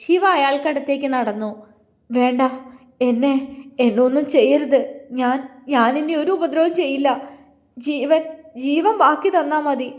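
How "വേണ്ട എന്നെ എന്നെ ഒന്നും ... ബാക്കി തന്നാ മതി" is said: stammering plea for his life, slowing at the end; terrified begging. A narrator's reading. Villain pleading with the hero for his life, terrified stammer. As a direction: beg for your life, stumbling over words. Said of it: "മരണഭയത്തിൽ Vasudevan പറഞ്ഞു".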